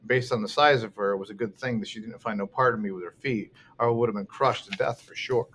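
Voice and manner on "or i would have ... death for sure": usual speaking voice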